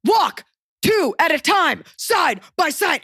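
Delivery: mock airport guard barking orders. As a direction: bark it like a drill sergeant.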